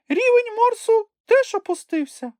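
loud, very high squeak; Chmykh exclaiming. A narrator's reading. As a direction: cry out in a tiny creature's falsetto. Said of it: "вигукнув він".

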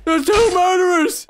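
high, loud, drawn-out imitation of alarm; hammed-up alarm cry; mock panicked shout. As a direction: yell it as the panicking players. Voicing players, louder repeat.

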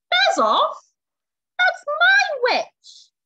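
very high, loud monster shriek; terrible beast voice. A narrator's reading. Horrible beast warning off the dragon, shrieking. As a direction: shriek it slowly as a storybook monster. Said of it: "Its terrible voice".

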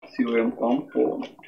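slow, unvoiced murmur as if typing; murmur while typing. Real, not acted. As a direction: say it under your breath, slowly, while typing. (Type করতে করতে ধীরে ফিসফিস)

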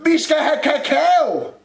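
high, loud holler; shouting. A narrator's reading. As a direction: yell the change of order into a cupboard. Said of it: "råbte Rumle ind i skabet". (råber højt)

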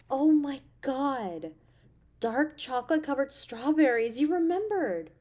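be thrilled by the surprise; delighted. Acted. Touched by the gift.

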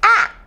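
short open "ah" for the letter A; phonics sound. Shown as a demonstration. Teacher showing kids the sound A makes, a clear "ah". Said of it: "A says".

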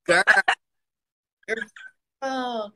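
ordinary delivery

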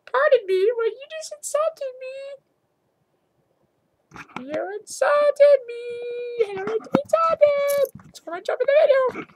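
very high, indignant little voice; insulted squeaky character. Pretend. Puppy character objecting to an insult, squeaky indignant voice. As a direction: squeal it in comic offence.

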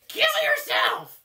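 high, drawn-out yell; furious shout. Acted. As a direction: hurl it slowly at the top of your voice. Lashes out after being hurt.

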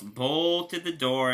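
ordinary delivery

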